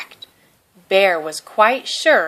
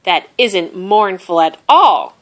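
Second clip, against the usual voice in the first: louder, mildly cross protest; indignant cry; 7 dB louder than usual. A narrator's reading. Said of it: "cried Bear".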